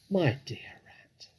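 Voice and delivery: slow, breathy whispered menace; soft whisper. A narrator's reading. Fox whispers to the rat before threatening him. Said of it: "he said softly".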